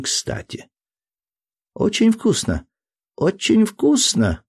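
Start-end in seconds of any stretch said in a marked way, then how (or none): 1.7-4.4 put-on high child voice, mouth full. A narrator's reading. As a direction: talk through a mouthful of fruit. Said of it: "с полным ртом говорил мальчик".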